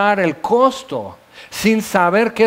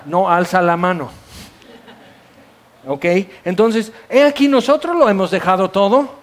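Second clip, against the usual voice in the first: normal talking voice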